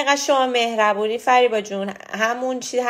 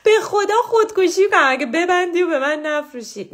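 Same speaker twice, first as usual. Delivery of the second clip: high, loud laughing sister's plea; imitating sister; 10 semitones higher, 6 dB louder than usual. Sister begging her not to sell the page, loud laughing mock plea. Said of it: "خواهرم میگه".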